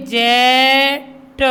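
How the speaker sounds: जेट drawn out, final ट split off; sounded out. Shown as a demonstration. Teacher showing children short e phonics, जेट slowly sounded out. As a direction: stretch the vowel of जेट, pause, then a separate "tuh". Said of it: "तो इसको क्या बोलेंगे?".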